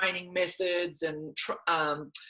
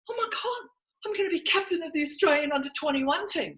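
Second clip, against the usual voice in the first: hopeful; 10 semitones higher, 4 dB quieter, faster than usual. Retold or imitated. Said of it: "and then I thought".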